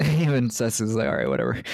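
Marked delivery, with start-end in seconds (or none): 1.1-1.6 much lower, bored dude voice; deep mock voice. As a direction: drop way down for a bored brush-off. Mimicking Cecily's bored reply.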